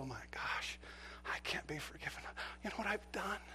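fearful hushed whisper. Pretend. Sinner at God's door, ashamed whisper. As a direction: breathe it out in shame.

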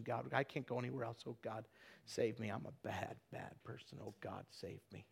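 very soft, low, pleading whisper-prayer; broken confession. Retold or imitated. Husband pleading with God to save him, hushed and broken. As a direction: confess it in a near-whisper, crushed by guilt. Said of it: "he goes".

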